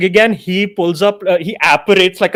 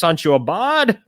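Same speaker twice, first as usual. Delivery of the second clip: ordinary delivery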